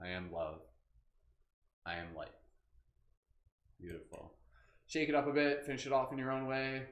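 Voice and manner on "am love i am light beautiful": low, soft meditative affirmation; calm, hushed affirmation. Shown as a demonstration. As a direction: speak it gently, sinking low and quiet. Leading spoken affirmations to viewers.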